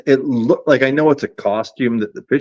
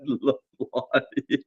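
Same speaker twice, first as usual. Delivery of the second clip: laughing; 4 semitones higher, 5 dB quieter, much slower than usual. Real, not acted. Laughing at the funny name.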